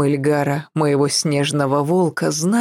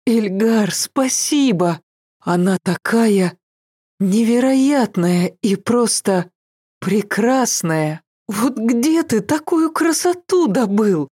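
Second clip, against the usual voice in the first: rising, breathless delight; 6 semitones higher than usual. A narrator's reading. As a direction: gush over the gift with joy. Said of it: "простонала я от наслаждения".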